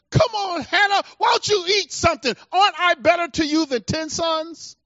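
lightly acted pleading husband, pitched high; a husband's high-pitched protest, lightly put on; Elkanah. Acting Elkanah coaxing his wife Hannah.

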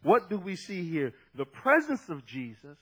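ordinary talk, nothing marked

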